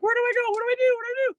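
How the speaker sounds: very high, loud panicked imitation; freaking out. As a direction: imitate a frantic coworker in a squealing high voice. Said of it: "she's freaking out".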